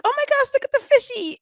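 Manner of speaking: high, loud burst of wonder; excited gasp. Retold or imitated. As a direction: gush over the fishy like a thrilled kid. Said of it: "and I'm like".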